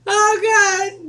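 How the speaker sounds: high, loud, through laughter; laughing exclamation. Real, not acted. Woman amused at turning into her mother, laughing. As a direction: cry it out as the laugh subsides.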